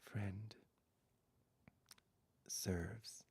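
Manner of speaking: slow, soft, whispered meditation voice; hushed closing meditation, words spaced out; guided meditation. Acted. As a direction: ease them into rest, barely above a whisper.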